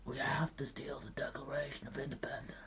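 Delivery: high, hushed, breathy whisper; hammed-up breathy action hero impression; Nicolas Cage. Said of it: "He's very breathy".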